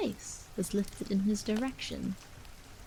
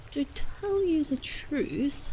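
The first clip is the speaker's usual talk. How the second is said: picking words; 6 semitones higher, slower than usual. Acted. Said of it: "picking his way as if it were from word to word".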